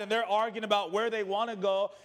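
ordinary delivery

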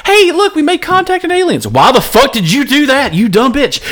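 high shrill mock outrage; mock alien scolding. Mocking alien-contact movie scene.

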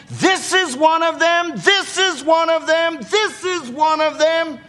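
high, insistent repeated accusation; persistent accusation. Acted. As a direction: keep pointing him out, pitched high, over and over. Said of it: "Nothing like a persistent woman".

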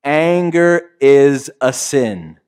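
slow, weighty, stress on Anger; slow emphatic statement. Shown as a demonstration. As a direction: say "Anger is a sin" slowly, leaning on "Anger". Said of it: "Wanna try that?".

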